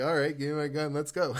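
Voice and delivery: loud, eager retelling; mock gung-ho. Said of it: "I was like".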